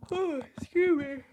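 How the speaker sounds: talking through a yawn; stretched mid-yawn. Real, not acted. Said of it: "I'm yawning".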